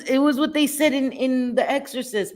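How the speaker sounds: ordinary talk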